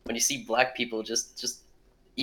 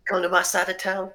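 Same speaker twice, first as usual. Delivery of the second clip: higher put-on street thug; tough guy voice; 10 semitones higher than usual. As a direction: menace like a cartoon gangster. Friends trading tough guy voices.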